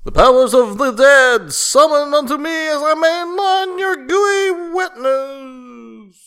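very high, loud ritual wail, drawn out; mock incantation. Joker calling up powers of the dead, hammed-up incantation. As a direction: chant it like an over-the-top summoning spell.

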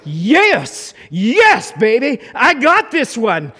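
loud, gloating cheer of a man who nailed it; big swaggering yes, baby; cocky triumph. Retold or imitated. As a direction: crow it like you just won. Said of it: "This was like".

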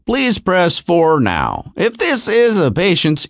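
very slow, drawn-out recorded greeting; doctor's outgoing message. Retold or imitated. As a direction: drag it out like an endless answering machine. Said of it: "thirty-minute outgoing message".

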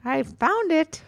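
high, sing-out triumph; high-pitched little ta-da; triumphant. Real, not acted. Mother triumphantly finds the episode.

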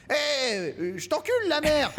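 high-pitched marionette heckle; rude puppet. Retold or imitated. Ventriloquist's puppet insults the crowd, high cheeky voice. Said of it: "puis il fait".

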